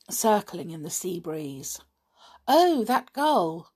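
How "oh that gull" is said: breezily bright opening exclamation. A narrator's reading. Dick explaining away the noise as gulls, brightly. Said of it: "said Dick brightly".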